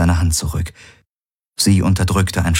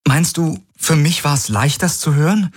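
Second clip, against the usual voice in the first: put-on higher, tearful young woman; Ki, pleading; 10 semitones higher than usual. Ki begs Galen in a trembling voice. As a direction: plead with him, close to tears. Ki's voice in a sad love scene.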